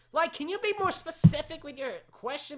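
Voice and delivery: high, loud frustrated plea; exasperated. Real, not acted. Host to unclear questioner, exasperated. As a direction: plead for clarity, worked up.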